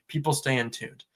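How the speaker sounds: ordinary talk, nothing marked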